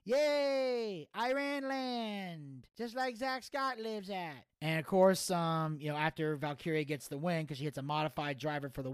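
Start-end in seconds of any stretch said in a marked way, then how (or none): 0.0-4.4 high, drawn-out goofy cheer; Gator. Retold or imitated. Friend confusing countries while cheering the champion, high drawn-out put-on voice. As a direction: celebrate slowly in a high put-on voice. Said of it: "Gator was like".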